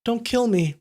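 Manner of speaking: high, frightened plea; begging. A narrator's reading. Trembling boy kneels before the swordsman, begging. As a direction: beg for your life in a thin, high voice. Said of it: "he begged".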